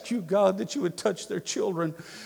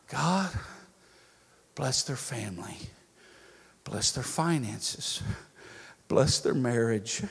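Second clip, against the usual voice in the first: slow, lower blessing prayer; 4 semitones lower, slower than usual. Shown as a demonstration. Pastor modelling a prayer of blessing for enemies, slow and low. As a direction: pray each blessing slowly and gently.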